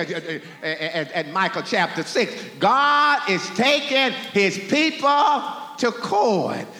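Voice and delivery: ordinary delivery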